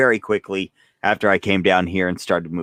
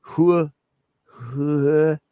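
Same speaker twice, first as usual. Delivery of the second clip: drawn-out, high wordless "hua"; stretched slow-motion mouth noise; mock slow-motion speech; 6 semitones higher than usual. Shown as a demonstration. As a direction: imitate your own slowed-down footage. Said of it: "try to slow down my words".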